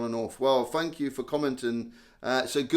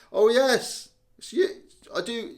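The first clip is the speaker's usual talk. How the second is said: much higher, surprised and pleased; light up as you spot a friend; happy surprise at a familiar name; delighted recognition; 10 semitones higher, 4 dB louder than usual. Real, not acted. Recognises a commenter he knows.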